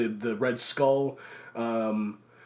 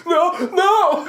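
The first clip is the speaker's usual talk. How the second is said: much higher and louder, laughing; imitated crowd protest; 9 dB louder than usual. Retelling the audience as Thanos charges the gauntlet, mock wail. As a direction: cry out in hammed-up alarm. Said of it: "everybody's like".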